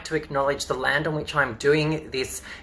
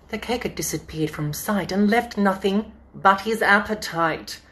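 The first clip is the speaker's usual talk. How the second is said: bouncy storybook rhyme, climbing higher; reading to children; 4 semitones higher than usual. Acted. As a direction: read it like a lively bedtime story. Reading picture book to children.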